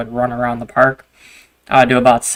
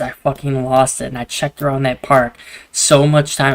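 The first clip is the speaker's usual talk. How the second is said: usual speaking voice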